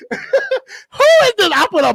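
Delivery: very high, drawn-out squeal of laughter; slow, high, laughed-out mock question; cracking up. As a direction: mimic the question while helpless with laughter. Repeats onlookers' question, cracking up.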